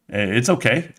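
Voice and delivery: ordinary delivery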